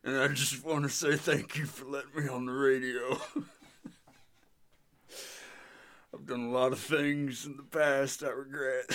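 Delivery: Meat Man voice. Pretend. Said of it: "Came up with Meat Man".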